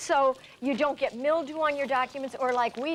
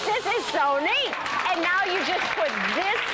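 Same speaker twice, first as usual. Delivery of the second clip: excited; 5 semitones higher, 3 dB louder than usual. Real, not acted. Host amazed at demo result.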